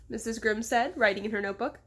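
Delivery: plain reading voice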